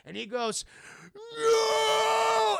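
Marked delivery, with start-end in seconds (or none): 1.4-2.6 higher, louder drawn-out no; mock heroic cry. Hubie stopping the fire, hammed-up heroic wail. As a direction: belt out a hero's anguished no.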